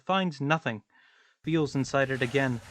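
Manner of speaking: usual speaking voice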